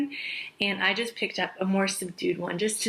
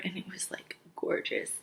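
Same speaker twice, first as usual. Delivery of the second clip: whispering; 9 dB quieter than usual. Real, not acted. Gushing about loved bronzer.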